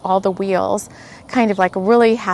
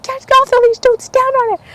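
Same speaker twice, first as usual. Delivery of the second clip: very high, raised mock shout; retold yelling, nicely meant; 4 dB louder than usual. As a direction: call it out high, imitating a well-meant yell. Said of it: "yelling at him".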